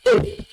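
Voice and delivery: E as French é, short; high E sound. Shown as a demonstration. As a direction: demonstrate E as a high 'ay' sound. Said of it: "it is having a high sound".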